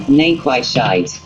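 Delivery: ordinary delivery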